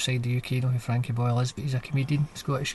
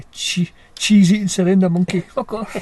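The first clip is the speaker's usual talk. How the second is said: louder, jeering mock insult; 4 semitones higher, 7 dB louder than usual. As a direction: hurl it like a tabloid jingo, laughing.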